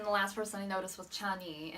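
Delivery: ordinary talk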